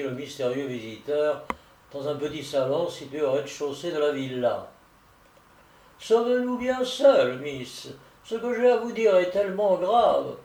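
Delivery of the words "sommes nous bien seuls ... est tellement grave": higher, projected solicitor's voice with dropped "miss"; visiting solicitor. Acted. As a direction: confide grave business in a theatrical voice. (voix théâtrale de solliciteur en visite)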